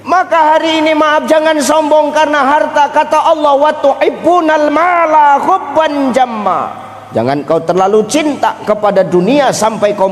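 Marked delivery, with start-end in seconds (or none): 3.5-6.7 slow, high chanted verse; Quranic recitation. Acted. (lantunan ayat Al-Qur'an yang pelan)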